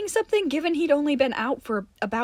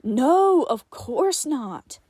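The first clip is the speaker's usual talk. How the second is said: slow sarcastic scoff, first word loud; 3 semitones higher, 8 dB louder, much slower than usual. Acted. Tim scoffing at Johnny's defense of Martin. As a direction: drag it out mockingly. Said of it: "Tim scoffed".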